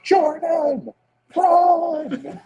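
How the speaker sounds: very high, loud imitated yell; panicked-clip yell. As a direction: yell it out like the tackle warning his quarterback. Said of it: "And he just yells".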